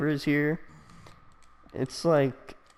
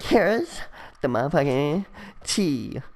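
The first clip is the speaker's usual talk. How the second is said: put-on sassy girl; 2 semitones higher than usual. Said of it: "the girls are just like".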